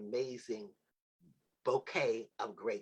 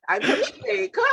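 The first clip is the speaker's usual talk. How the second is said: high, loud, laughing Jamaican imitation; mock Jamaican accent; 12 dB louder than usual. Host imitating Krilios introducing himself, laughing Jamaican accent. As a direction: squeal it out in a Jamaican lilt through laughter. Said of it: "Not that being my accent".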